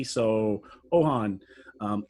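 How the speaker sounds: ordinary talk, nothing marked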